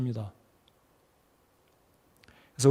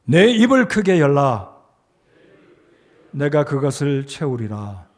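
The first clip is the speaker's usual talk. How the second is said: verse to repeat; 3 dB louder than usual. Shown as a demonstration. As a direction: proclaim it phrase by phrase for the congregation. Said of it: "이 말씀을 한번 따라서 해보십시오".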